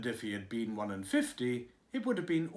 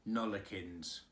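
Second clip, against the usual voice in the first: stolid gardener; 6 semitones lower, 4 dB quieter than usual. A narrator's reading. Gardener answering the nurse, low and stolid.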